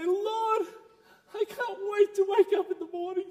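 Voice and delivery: high, eager voice as if praying at bedtime; bubbling over with eagerness to talk to God; eager bedtime prayer. Retold or imitated. Preacher quoting her own bedtime prayer, high and eager. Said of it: "I say".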